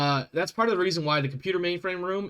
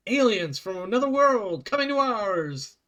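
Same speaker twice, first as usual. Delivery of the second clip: slow, dramatic, higher announcer voice; old-time radio announcer; 6 semitones higher, 4 dB louder, slower than usual. Acted. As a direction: boom it out like a fifties radio serial. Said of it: "that old school style of".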